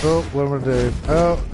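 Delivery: a bit tipsy, slurring; tipsy, loose speech. Real, not acted. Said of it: "I'm a bit drunk".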